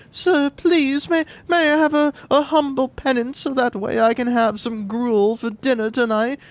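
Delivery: high, pleading little orphan voice; pleading orphan boy. Retold or imitated. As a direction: beg like a poor child asking for gruel. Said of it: "a little orphan boy would come up to me and would be like".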